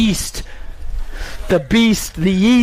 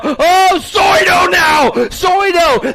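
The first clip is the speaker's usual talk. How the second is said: much higher and louder "Soido now!"; excited yell; 9 semitones higher, 3 dB louder, much slower than usual. Real, not acted. Announcer erupting over a rider's big move.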